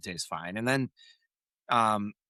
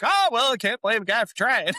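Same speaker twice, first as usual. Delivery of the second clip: shrugging it off; 10 semitones higher, 4 dB louder, faster than usual. Retold or imitated. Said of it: "He's like".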